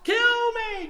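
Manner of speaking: very high, loud, stretched cry; imitated top-of-lungs yell. Preacher imitating a drunk neighbour, yelling at full lungs. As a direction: scream it as the drunk man. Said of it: "just yelling at the top of his lungs".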